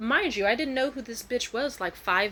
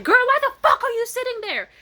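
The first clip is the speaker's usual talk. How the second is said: high, loud furious shout; 10 dB louder than usual. Retold or imitated. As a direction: scream it at her. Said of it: "then she was like".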